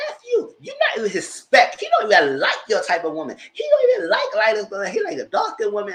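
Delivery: mock angry retort. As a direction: bite back in a mimicked woman's voice. Retold argument quote.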